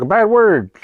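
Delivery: put-on high, loud kid voice; imitated little sister. Retelling the sister's repeated answer, high loud kid voice. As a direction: mimic a stubborn small child. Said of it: "She's like".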